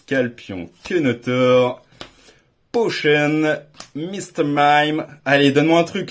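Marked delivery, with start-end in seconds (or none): none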